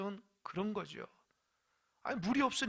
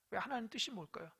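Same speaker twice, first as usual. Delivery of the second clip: ordinary talk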